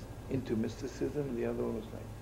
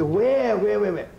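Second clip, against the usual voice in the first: urgent questioning; 7 semitones higher, 9 dB louder, slower than usual. Retold or imitated. Police urgently asking where.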